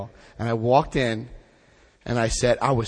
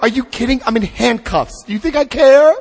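exasperated outburst; 11 semitones higher, 10 dB louder than usual. Retold or imitated. Handcuffed, arguing with district attorney.

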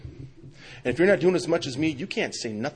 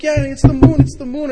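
high, loud, thrilled dad; excited; 14 semitones higher, 15 dB louder than usual. Retold or imitated. Dad answering his daughter about the moon, very excited. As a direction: share the child's thrill at full pitch. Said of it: "And I'm so excited".

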